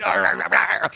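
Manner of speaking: loud repeated road-rage growl; mock frustrated growling. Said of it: "I kinda grumble in the car".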